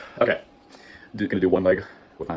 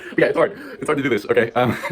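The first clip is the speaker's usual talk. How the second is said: breaking into laughter, higher and louder; half-laughing protest that it's hard; 4 semitones higher, 7 dB louder than usual. Real, not acted. Defending herself to viewers while laughing. Laughing after falling over.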